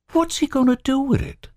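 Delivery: high, frightened question; fearful. Acted. Robert Lee to Albert, fearful. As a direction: dread in the voice. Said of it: "knot again with fear".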